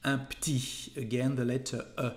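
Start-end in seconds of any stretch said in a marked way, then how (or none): 0.0-0.9 petit said as p'tit, e swallowed; casual French un petit; dropped e. Shown as a demonstration. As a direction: say petit like ptit. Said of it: "you can say un petit".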